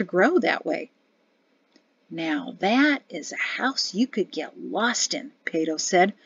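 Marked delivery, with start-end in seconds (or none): 2.1-5.3 low, slow and soft, quietly plotting; hint of scheming. A narrator's reading. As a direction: muse slowly in a lower, softer voice, a plan forming. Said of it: "with a hint of planning in his voice".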